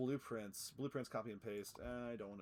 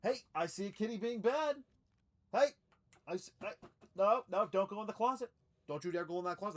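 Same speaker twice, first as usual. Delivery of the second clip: scolding the cat; 9 semitones higher, 7 dB louder than usual. Real, not acted.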